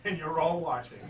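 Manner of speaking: ordinary talk, nothing marked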